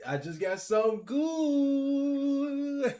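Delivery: high, slow, drawn-out hype; excited. Real, not acted. Gamer hyping his pack pull, slow high excitement. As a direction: stretch good out with anticipation. Anticipating a good card.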